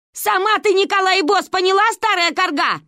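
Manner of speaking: high, loud outburst of insults; indignant retort. A narrator's reading. Aunt Valya finally answers Ba, high indignant outburst. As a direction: find your tongue and fling the insult back. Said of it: "Наконец обрела дар речи тётя Валя".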